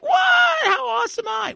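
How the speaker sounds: high, showy mock brag; mock boastful shout. Imagined guest showing up with flowers, mock boastful shout.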